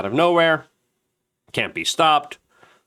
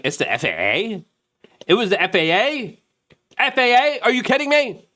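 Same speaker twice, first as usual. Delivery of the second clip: incredulous outburst; 11 semitones higher than usual. Retold or imitated. Reacting to the FAA answer, growing higher incredulity. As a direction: build from doubt to outrage. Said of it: "And I'm like".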